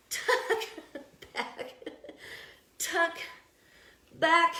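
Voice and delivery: words dissolving into breathy laughter; laughing through effort. Real, not acted. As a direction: count the reps while helplessly laughing. Laughs while struggling through tucks.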